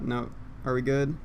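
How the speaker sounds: ordinary delivery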